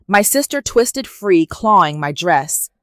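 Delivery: ordinary delivery, nothing marked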